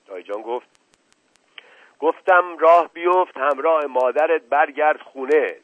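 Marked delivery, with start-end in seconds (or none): 2.0-5.5 loud, higher, forceful order; stern command. Acted. Uncle repeating order to disobedient girl, stern command. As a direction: insist with angry authority.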